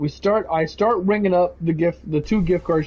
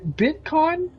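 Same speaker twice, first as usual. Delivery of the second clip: "Bitcoin?" said higher with a rising questioning tune; customer asking; 11 semitones higher than usual. Retold or imitated. Customer naming the payment method, "Bitcoin?" high and rising. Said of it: "And she goes".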